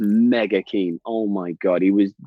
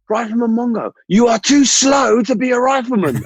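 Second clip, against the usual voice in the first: hammed-up boss chanting his judgement; boss's verdict; 13 semitones higher, 5 dB louder than usual. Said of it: "And he just went".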